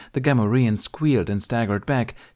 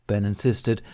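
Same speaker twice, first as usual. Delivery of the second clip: ordinary delivery, nothing marked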